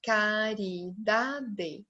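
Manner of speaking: CARIDADE softly and very slowly, ca-ri-da-de; four soft, separate beats on caridade; split into syllables. Shown as a demonstration. Teacher counting four syllables, softly syllable by syllable. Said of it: "Eu tenho quatro sílabas".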